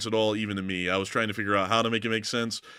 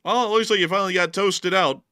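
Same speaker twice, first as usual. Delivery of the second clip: taunting; 8 semitones higher, 5 dB louder than usual. Real, not acted. Taunting destroyed vehicle.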